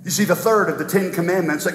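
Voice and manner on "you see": normal talking voice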